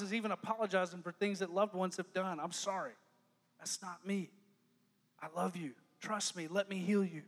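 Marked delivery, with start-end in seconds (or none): 2.4-7.2 soft, low, gentle; tender apology. Acted. God apologizing to a wounded child, soft and tender. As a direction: whisper comfort.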